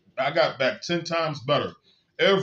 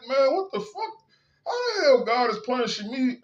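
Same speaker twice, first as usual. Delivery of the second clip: incredulous outrage; 9 semitones higher than usual. Retold or imitated. Retold disbelief at being blamed.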